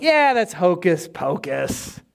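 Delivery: slow, drawn-out scoffing; acted slow disbelief; dismissive skeptic. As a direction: drag it out like an unimpressed doubter. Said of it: "still being like".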